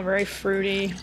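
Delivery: ordinary delivery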